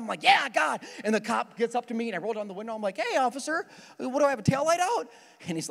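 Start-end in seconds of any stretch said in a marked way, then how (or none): 0.2-0.8 higher, louder pumped-up cheer; psyched up. Retold or imitated. Driver pumping himself up with God before the cop arrives. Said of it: "getting myself psyched up". 3.0-5.1 higher, breezy fake innocence; mock innocent. Speeding driver playing innocent to the officer, higher.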